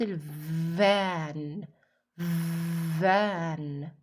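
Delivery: "فان" as van with a long buzzing v; stretched opening V. Shown as a demonstration. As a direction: draw out the voiced v that starts "فان". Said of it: "أو زي صوت إيه؟".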